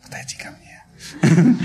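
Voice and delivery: slow, airy whisper calling someone over; drawn-out beckoning whisper; whispered female voice. Retold or imitated. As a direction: imitate a woman whispering an invitation. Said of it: "Такой женский голос".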